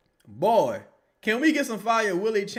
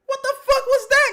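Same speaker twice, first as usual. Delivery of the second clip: laughing outburst; 7 dB louder than usual. Real, not acted. Laughing disbelief at a play.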